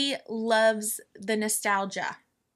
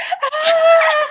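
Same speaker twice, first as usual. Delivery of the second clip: high, loud, drawn-out mock scream; imitated fan scream; 8 dB louder than usual. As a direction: scream for attention like a crazed fan. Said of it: "the whole time she's screaming".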